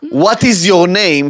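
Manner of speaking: put-on loud, slow blunt Israeli accent. Acting an aggressive question.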